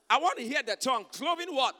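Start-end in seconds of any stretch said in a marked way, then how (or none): 1.1-1.7 high shouted call; shouting. Real, not acted. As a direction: shout for the crowd's answer. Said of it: "I can't hear you".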